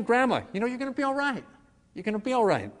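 calm her fears, lifted and gentle; reassuring. Retold or imitated. Grandson comforting grandma in the ambulance, high soothing reassurance.

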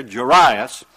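Jairus said as "juh-RYE-us"; persnickety pronunciation. Shown as a demonstration. Preacher correcting the ruler's name, Jairus as "juh-RYE-us". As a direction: stress the middle of Jairus, "rye". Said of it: "persnickety about the way you pronounce his name".

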